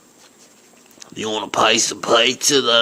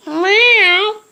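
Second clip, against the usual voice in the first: high mock cat meow; 6 dB louder than usual. The kitty answering its owner, high meow.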